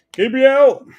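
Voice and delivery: high, loud yell of the name; sudden high-pitched cheer of a player's name; excited shout. Real, not acted. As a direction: call the name out big.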